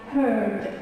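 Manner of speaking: American "heard" with a held R; American accent. Shown as a demonstration. Teacher gives the American one for class, American accent. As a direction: sound the R fully in "heard", American style. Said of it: "the American one is".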